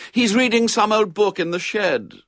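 high, loud tattling announcement; announcing. A narrator's reading. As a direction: report on your brother so all can hear. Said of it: "he heard her announce".

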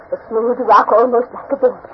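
ordinary talk, nothing marked

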